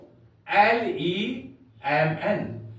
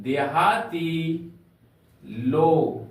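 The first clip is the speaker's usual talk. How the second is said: slow teaching repeat; 3 semitones lower, much slower than usual. Shown as a demonstration. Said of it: "फॉक का अर्थ होता है देहाती लोग".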